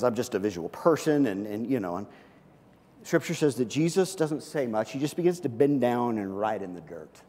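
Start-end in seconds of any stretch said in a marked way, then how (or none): none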